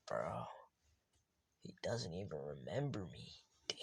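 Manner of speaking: put-on retelling a girl's heartbroken thoughts, whispered; hurt inner whisper. Voicing Katsumi's hurt inner thoughts.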